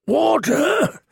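high, thin gasp for water; gasped plea. Acted. Bearded prisoner gasps to the girl for water. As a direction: gasp it out, parched. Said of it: "gasped the one".

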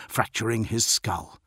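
ordinary delivery

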